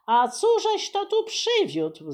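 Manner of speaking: high, appalled question; exasperated disbelief; aghast cook. Acted. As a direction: cook clutching his head at the goats. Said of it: "złapał się za głowę".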